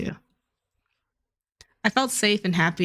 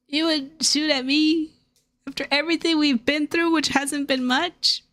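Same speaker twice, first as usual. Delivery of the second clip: melodramatic betrayal; 7 semitones higher than usual. Pretend. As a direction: ham up the betrayed friend.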